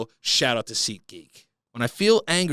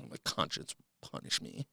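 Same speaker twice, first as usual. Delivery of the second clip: a sighing, voiceless, much softer mock reading of "my conscience would punish me"; mock whisper; 5 semitones lower, 13 dB quieter than usual. Mock redo after "Ew.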